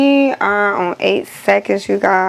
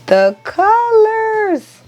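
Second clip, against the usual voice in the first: excited awe; 11 semitones higher, much slower than usual. Real, not acted. Admiring fresh print.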